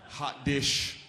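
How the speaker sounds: usual speaking voice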